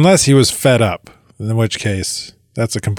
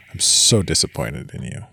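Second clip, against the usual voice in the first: hushed, lower, restrained disappointment; quiet parental disappointment; 6 semitones lower, 7 dB quieter than usual. Acted. Parent who needn't shout tells the child off, quietly disappointed. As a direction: deliver it softly, no yelling, letting the disappointment cut. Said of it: "I don't have to yell and shout".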